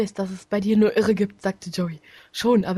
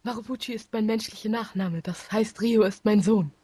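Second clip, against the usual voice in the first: normal talking voice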